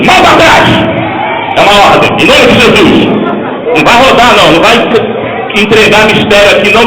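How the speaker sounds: high, loud commanding yell; rebuking shout. Real, not acted. Pastor shouting down Satan in deliverance. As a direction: cast the demon out with full force.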